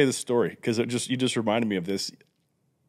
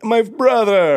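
excited greeting; 10 semitones higher, 7 dB louder, much slower than usual. Retold or imitated. Warm loud greeting.

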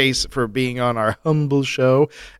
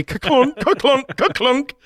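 high mock ka-clunk; bell sound; 10 semitones higher than usual. Host imitating the iron bell of quality, high.

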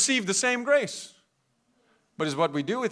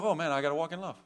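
reluctant reply; 5 semitones lower, 8 dB quieter, much faster than usual. Retold or imitated. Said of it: "it's like".

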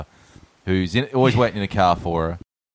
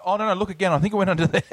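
eager pleading; 10 semitones higher, faster than usual. Retold or imitated. Voicing the hero urging her.

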